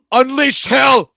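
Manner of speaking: slow booming war cry; battle cry. Pretend. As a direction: roar it like a general charging. Cracking open the beer dramatically.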